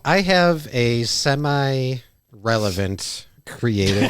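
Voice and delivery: halting after "semi-relevant", word by word; long pauses. Real, not acted. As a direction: leave big gaps in "semi-relevant creative", slowly. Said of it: "paused way too long after semi".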